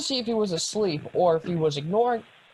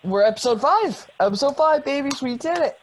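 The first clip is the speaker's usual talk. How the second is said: excited cheer; 7 semitones higher, 3 dB louder than usual. Real, not acted. Celebrating reaching episode five.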